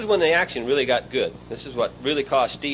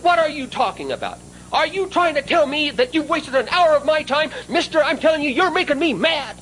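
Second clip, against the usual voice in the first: much higher mock indignant outburst; carrying on; 13 semitones higher than usual. Buyer staging fake anger at the sales manager, high-pitched mock outrage. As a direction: play up hammed-up outrage to rattle the dealer. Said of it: "I carried on just like a".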